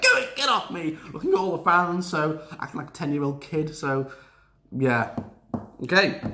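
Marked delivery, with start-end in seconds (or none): 0.0-1.0 higher, mock tantrum snap; hissy fit. Bayley yelling at fans after the match, mock tantrum. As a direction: throw a lightly mocking tantrum. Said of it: "having a hissy fit".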